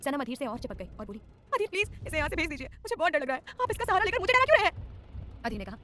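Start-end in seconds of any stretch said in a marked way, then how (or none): 1.5-2.7 higher, louder desperate begging; frightened pleading. A narrator's reading. Heroine begs, afraid of lion. 2.7-3.4 higher, louder scared admission; frightened pleading. A narrator's reading. Heroine begs, afraid of lion. 3.6-4.9 high, loud frightened accusation; panicked protest. A narrator's reading. Heroine protests in fear.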